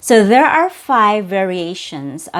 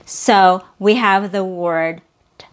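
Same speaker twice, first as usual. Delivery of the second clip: usual speaking voice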